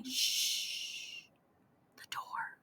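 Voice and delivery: faint unvoiced hush; whisper. Vladek warning Anya the door is opening, whispered. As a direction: barely breathe the warning.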